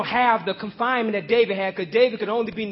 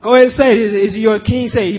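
ordinary talk, nothing marked

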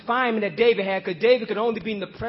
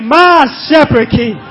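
slow, full-voiced conviction; heartfelt, drawn-out declaration; like you mean it; 11 dB louder, slower than usual. Shown as a demonstration. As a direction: declare it slowly and wholeheartedly. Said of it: "say it like you mean it".

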